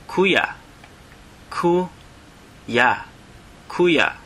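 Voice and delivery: "kuya" as KOO-ya, aspirated k; Tagalog word for brother. Shown as a demonstration. Teacher pronouncing "kuya" for learners. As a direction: repeat "kuya" clearly, stress first syllable. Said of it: "Brother is".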